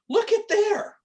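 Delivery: high-pitched imitated exclamation; excited call. Retold companion's excited call.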